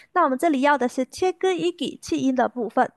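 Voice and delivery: ordinary delivery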